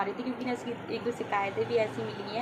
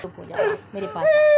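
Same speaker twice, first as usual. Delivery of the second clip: soothing over crying; 14 semitones higher, 11 dB louder than usual. Real, not acted. Comforting crying son.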